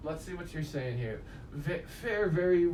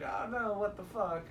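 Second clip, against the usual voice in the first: mock shocked; 7 semitones higher, 4 dB quieter than usual. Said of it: "obviously, they're like".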